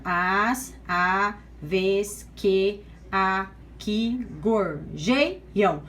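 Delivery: "As aves que aqui gorjeiam" very slow and low, one syllable at a time; measured syllable-by-syllable reading of "As aves que aqui gorjeiam"; separated syllables. Shown as a demonstration. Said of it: "Então, vamos lá separando".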